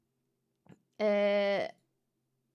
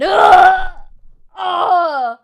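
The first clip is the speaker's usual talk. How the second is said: mock scream; 6 semitones higher, 16 dB louder than usual. Mock horror at chat.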